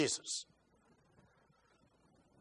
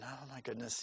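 soft, low eye-rolling groan; a mock weary sigh of annoyance; exasperated sigh; 6 semitones lower, 16 dB quieter than usual. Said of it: "roll his eyes and big sigh".

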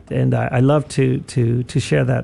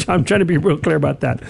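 ordinary talk

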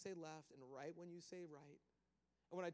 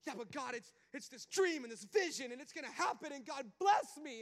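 eager pleading; 6 semitones higher, 10 dB louder than usual. Retold or imitated. Pleading with God.

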